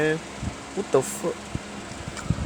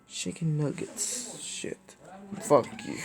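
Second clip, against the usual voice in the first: whispered mutter; 4 semitones lower, 11 dB quieter, slower than usual. Real, not acted. Suddenly drops to near silence.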